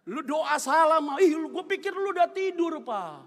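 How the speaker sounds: high, loud comic protest; acted marital bickering. Husband objecting to wife's prayer, loud mock indignation. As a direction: scold with mock exasperation.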